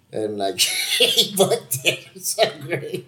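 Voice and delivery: words squeezed out through laughter; laughing. Real, not acted. Friend delighted that he booked it, laughing. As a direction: giggle through the line, losing your voice to it.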